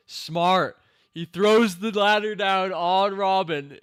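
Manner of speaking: high, loud and slowed for emphasis; thrilled play-by-play yell; excited shout. Real, not acted. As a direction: relish every word. Cheering the ladder throw.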